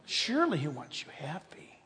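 soft unvoiced devil's whisper; tempting whisper. Preacher voicing Satan to congregation, tempting whisper. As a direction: breathe it like a tempter.

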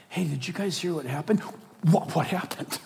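quiet mock gossipers; hushed gossip. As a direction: lean in conspiratorially. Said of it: "is drawn to".

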